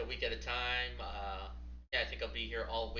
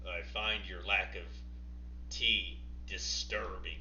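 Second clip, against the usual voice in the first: lower, slow Vader-style menace; menacing villain; 4 semitones lower, slower than usual. Acted. [name] tells the intruder his lack of tea is disturbing, slow and menacing. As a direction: draw out each word like a dark lord.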